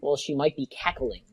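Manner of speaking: usual speaking voice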